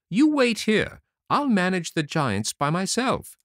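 high, sharp command; bossy little tailor. A narrator's reading. Tailor telling his followers to wait, high and bossy. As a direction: order the horsemen to stay put.

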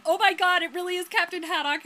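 loud, high exclamation of amazement; excited recognition. Real, not acted. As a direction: blurt it out, thrilled. Player's delighted recognition of character.

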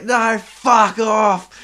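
put-on high, loud, slow drunken yell; drunk shouting. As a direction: roar it out drunk. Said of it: "the guy's like".

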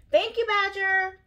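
high, loud, drawn-out squeak; squeaky mouse. A narrator's reading. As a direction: squeak it out slowly like a little mouse. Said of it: "Mouse squeaked".

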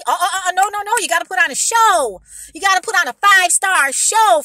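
loud sarcastic protest; sarcastic, rising indignation; mock outrage.

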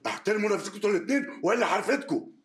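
boss scolding. Acted. Boss breaks up the quarrel.

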